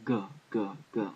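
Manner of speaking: "G, G, G" as short hard "guh" syllables, evenly spaced; hard G sound. Shown as a demonstration. Teacher demonstrating Gamil's sound to learners, "G" said as hard "guh". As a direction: sound out "G" as "guh", one at a time. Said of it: "which is a G sound".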